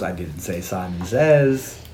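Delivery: low, slow taunting villain line; mock sinister villain. The villain taunting John over the phone, low slow and smug.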